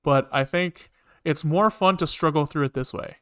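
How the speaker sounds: ordinary talk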